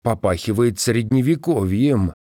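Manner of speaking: usual speaking voice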